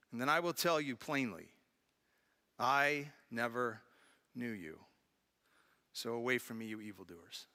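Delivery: grave, fading reading of the rejection; hushed solemn reading. Acted. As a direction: let the verdict fall to a near hush. Reads Jesus's stern verdict.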